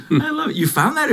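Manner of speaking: laughing, pitch jumps up high. Real, not acted. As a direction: laugh through the words. Laughing at partner's florid text.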